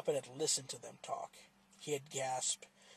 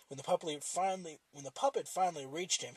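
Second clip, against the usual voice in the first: ordinary delivery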